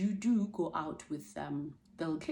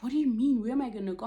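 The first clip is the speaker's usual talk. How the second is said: shocked protest; 6 semitones higher, 7 dB louder, faster than usual. Retold or imitated. Fired nanny protests in shock.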